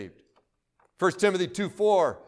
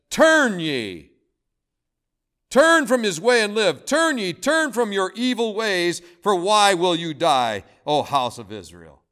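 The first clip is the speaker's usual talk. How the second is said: pleading sermon cry; preaching at full force; 4 dB louder than usual. Acted. Preacher voicing God to the congregation, pleading. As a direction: call them to turn, fervent and loud.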